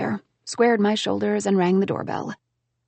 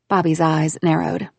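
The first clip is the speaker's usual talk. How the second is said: plain reading voice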